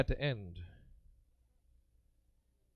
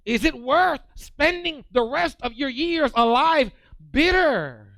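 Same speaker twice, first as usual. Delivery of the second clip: rising preaching; 9 semitones higher, 6 dB louder than usual. Acted. Preacher asking the congregation about peace, climbing to loud. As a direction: build the plea higher each line.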